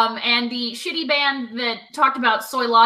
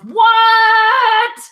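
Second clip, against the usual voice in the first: high, loud, drawn-out what; mock amazement; 11 semitones higher, 9 dB louder than usual. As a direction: exclaim it in hammed-up disbelief, nearly singing. Acted amazement at plant evolution joke.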